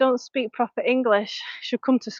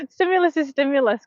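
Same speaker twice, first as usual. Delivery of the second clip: laughing repeat; 2 semitones higher than usual. Real, not acted. Coach on her live stream laughing as she repeats her motto. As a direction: chuckle it out as you say it again.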